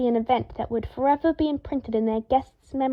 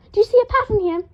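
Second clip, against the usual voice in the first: much higher, louder pointed question; exasperated demand; 11 semitones higher, 6 dB louder, faster than usual. A narrator's reading. Draco demands Harry see the pattern. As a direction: glare and demand an answer.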